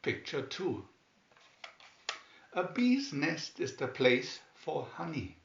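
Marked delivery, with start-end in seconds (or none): none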